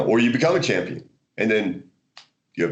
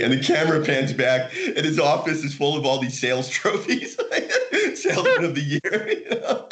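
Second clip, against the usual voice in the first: laughing; 4 semitones higher than usual. Real, not acted. Laughing at the punchline.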